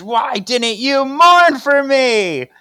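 high, loud, drawn-out accusing wail; anguished mock shriek. Host voicing Prue going in for the kill, anguished shriek. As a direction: shriek it in slow, hammed-up anguish.

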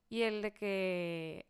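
usual speaking voice

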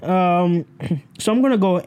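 ordinary delivery

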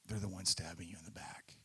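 whispered, as if telling a secret. Acted. Pastor telling the congregation about betrayal, whispering. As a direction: drop to a bare whisper for the betrayal.

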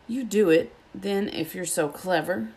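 ordinary delivery

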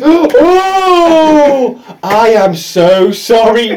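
long, loud, high "oh", then slow words; hammed-up embarrassed apology; mortified apology. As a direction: wail the first word, then draw out the sorry. Acting out friend's mortified apology.

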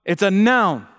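"noun" stretched and loud; emphasis on NOUN. Real, not acted. Preacher confirming the answer to the congregation, emphatic "noun". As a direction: say it loud, landing firmly on "noun". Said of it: "Why am I emphasizing that?".

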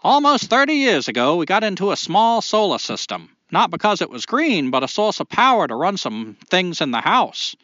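reading the letter in a hammed-up Maine accent. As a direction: put on a thick Maine accent for the listener letter. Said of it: "read Tim's write-in with a Maine accent".

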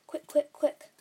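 rapid-fire urgent demand; urgent cry. A narrator's reading. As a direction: call out in frantic haste. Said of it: "he cried".

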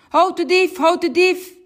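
high, loud alarm call; shouting. Acted. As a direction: yell it while running after thieves. Policeman runs blowing whistle.

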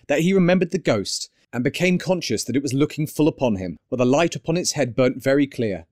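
ordinary delivery, nothing marked

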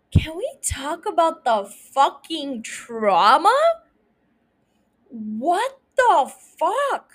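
slow, swooping, stretched-out incredulity; appalled disbelief. Real, not acted. Host reacts to a father losing every child, appalled disbelief. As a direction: drag the words out, aghast. Outraged reaction to the imagined loss.

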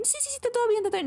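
high, rushed "I'm fine" brush-off; hasty denial. Retold or imitated. Woman asked if she's okay, brushing it off, high mock denial. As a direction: wave it away in a high voice, unconvincing.

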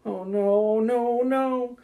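high, drawn-out dismay; distressed Donabella. Acted. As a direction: pitch climbing with each no. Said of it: "she's just like".

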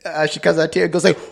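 ordinary talk

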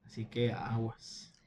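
hushed slow warning whisper. Real, not acted. Warning aside to listeners.

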